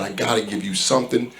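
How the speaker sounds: quiet, low retelling of a man about to cry; near tears. Retold or imitated. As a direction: imitate someone holding back tears, softly. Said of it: "about to start crying".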